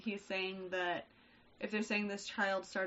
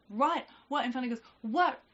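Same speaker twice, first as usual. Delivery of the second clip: retold exasperated dad; 4 semitones higher, 7 dB louder than usual. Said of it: "he's just".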